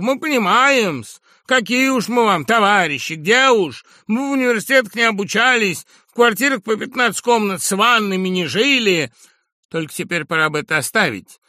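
high, loud, cocky and aggrieved; a brash lout talking back; crude upstart voice. Acted. Brash Sharikov talks back to the professor, high and loud.

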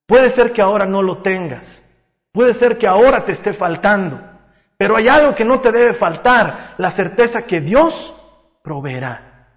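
fervent preaching. Real, not acted. Preacher reassuring those in need, fervent preaching. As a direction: build conviction phrase by phrase.

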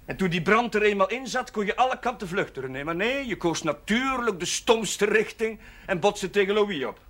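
loud and high, heated; angry berating. Acted. Priest berating René for fleeing the stupid way, loud and angry. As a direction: hammer home every mistake.

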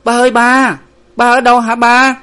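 high, sustained call into the dark; loud, high searching cry; calling out loudly. A narrator's reading. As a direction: call anxiously for your missing father. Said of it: "gọi to".

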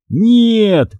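high, urgent narrated yell; crying out. A narrator's reading. Boy stopping friend throwing the card, crying out. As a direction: cry out to stop him, pitch leaping. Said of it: "закричал я".

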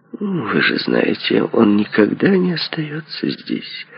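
slow, high-pitched reproach; reproachful. A narrator's reading. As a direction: draw it out reproachfully, voice lifted. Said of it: "с укором сказала она".

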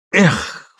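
high mock-despairing exclamation; feigned despair cry. A narrator's reading. Man cries out, faking despair before grabbing her. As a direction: ham up the despair. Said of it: "вскрикнул он в нарочитом отчаянии".

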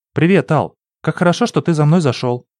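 put-on bright, glad young girl; girl's cheerful greeting. A narrator's reading. Narrator voices Lina, higher.

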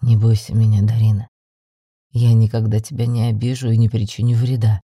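deep, firm reassuring male character voice; reassuring male lead. Acted. Йен calming the frightened heroine, deep and assured. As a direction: drop the pitch low and promise safety firmly.

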